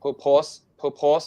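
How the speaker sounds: "purpose purpose" as per-pose, per-pose; Thai mispronunciation. Shown as a demonstration. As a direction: show the mistaken full o ending in "purpose purpose". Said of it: "ไม่ใช่".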